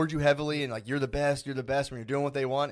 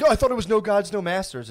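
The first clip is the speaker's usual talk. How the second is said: high, louder mock indignation; mock-offended higher whine; mocking whiny voice; 8 semitones higher, 6 dB louder than usual. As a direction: parody an offended commenter. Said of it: "they'll be like".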